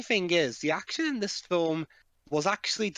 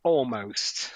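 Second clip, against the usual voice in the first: Almost drawn out, falling at the end; stress on Almost; 3 semitones lower, 3 dB louder than usual. Real, not acted. Echoing the review's faint praise, stress on Almost. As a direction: lean heavily on Almost. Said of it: "Emphasis on almost".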